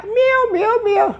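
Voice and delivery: very high mewing meow; cat meow. Shown as a demonstration. Teacher demonstrating a cat sound, very high meow. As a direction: mew like a cat. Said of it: "this means cat's voice".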